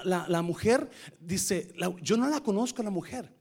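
usual speaking voice

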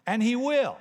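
high, loud "and he will"; booming promise from the pulpit; emphatic declaration. Real, not acted. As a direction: preach it out strong. Emphatic preaching.

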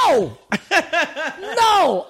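high-pitched indignant refusal; annoyed. Retold or imitated. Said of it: "I'm annoyed at this point".

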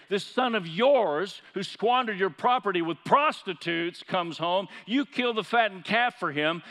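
slow, loud, high accusing cry; angry brother's complaint. Retold or imitated. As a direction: hurl the grievance at the father. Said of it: "is yelling at him".

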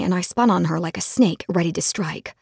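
ordinary delivery

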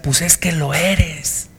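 a father brushing off his son; cold dismissive father. Retold or imitated. Papá responde con desprecio. (padre despectivo, lento y bajito)

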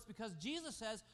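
normal talking voice

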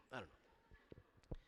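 breathy whisper, slow and trailing; whispered aside. Real, not acted. Preacher whispering sheepishly to the church after a joke.

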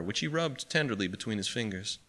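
plain reading voice

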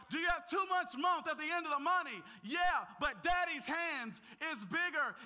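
loud, high preacher's cry; preacher at full force to the congregation; full-force preaching. Acted. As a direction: shout the story's climax to the church. Call-and-response preaching climax.

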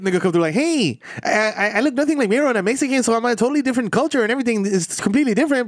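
high-pitched comedian impression; Gabriel Iglesias. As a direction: play a comedian's stage patter way up high. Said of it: "He be like".

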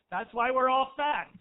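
hammy slow shout to the ladies; loud punchline. Real, not acted. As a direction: belt the punchline at the room.

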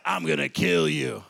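low, slow menacing threat; bully threat. Retold or imitated. Bully threatening the small boy, low slow menace. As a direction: growl the threat slowly.

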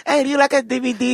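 mock DVD seller, high; mock Chinese seller. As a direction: hawk bootleg DVDs in a put-on accent.

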